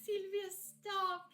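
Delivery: high, slow flustered squeal; drawn-out embarrassed protest. Real, not acted. Flustered reaction to steamy scene.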